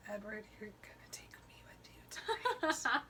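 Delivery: hushed, voiceless whisper to a partner. Retold or imitated. Bella whispers to Edward on the way home, whispered. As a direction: whisper it very softly and a little fast. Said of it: "she whispers to Edward".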